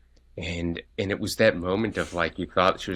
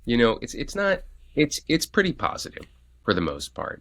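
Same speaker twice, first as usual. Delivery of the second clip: normal talking voice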